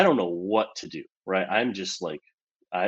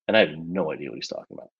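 normal talking voice

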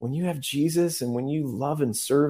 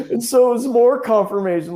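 laughing; 13 semitones higher, 8 dB louder than usual. Real, not acted. Laughing at the coincidence.

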